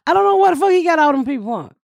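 ordinary talk

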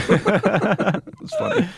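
laughing through the words; high, slow, giggling. Real, not acted. Laughing at retold joke.

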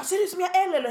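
mock outrage; yelling customer. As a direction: imitate the affronted customer. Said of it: "hon bara står och skriker".

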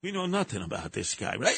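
normal talking voice

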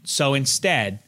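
usual speaking voice

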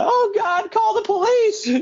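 very high shrieking mock panic; hammed-up frantic yell; panicked shout. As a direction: scream for help in terror. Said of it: "shouts for someone to call the police".

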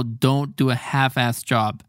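each word spelled out slowly; careful teaching pace; slow translation. Shown as a demonstration. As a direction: say it slowly for learners. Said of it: "Es como".

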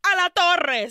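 loud, high rallying cry; rallying shout. Retold or imitated. Girls rushing to the tower, a loud rallying shout. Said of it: "they're like".

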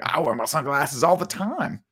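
high, drawn-out Florida cousin voice; slow, high put-on character voice; Florida cousin impression. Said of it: "who grew up in, in Florida and was like".

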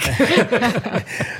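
high, bursting laugh; high delighted laugh. Real, not acted. Laughs at iconic remark.

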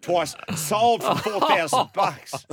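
mock auctioneer closing the sale, loud and drawn out; auctioneer call. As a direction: hammer it down like an auctioneer. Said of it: "He goes".

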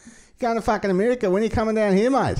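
hammed-up Aussie fan calling out; Australian accent. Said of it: "they're all like".

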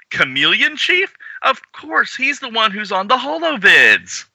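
surprised recognition. Acted. Voicing surprised comic character.

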